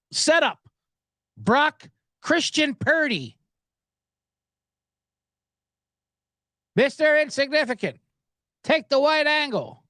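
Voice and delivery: slow, drawn-out mock host intro; hammed-up segment announcer, slowed right down; Right Angle segment parody. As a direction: announce it like a dramatic show opener. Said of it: "this parody I'm doing of right angle".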